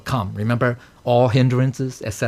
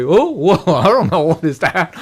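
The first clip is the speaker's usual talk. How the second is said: laughing, higher mock confusion; mock confused; 4 semitones higher than usual. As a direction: play a puzzled student, laughing. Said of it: "we say".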